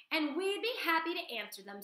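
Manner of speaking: high, breathy kind voice; lightly voiced motherly goose; friendly goose. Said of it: "said Loudwing".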